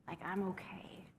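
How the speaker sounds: whispered to oneself. Real, not acted. Teacher whispering a self-reassurance to the class. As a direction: breathe the words to yourself. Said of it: "put my hand on my heart".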